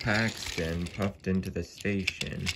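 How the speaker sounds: ordinary delivery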